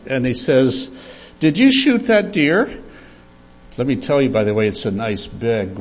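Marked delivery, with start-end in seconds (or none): 1.4-2.7 put-on higher, rising little-kid question; curious little boy. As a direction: lightly imitate a curious small boy asking. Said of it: "then Eddie looks up".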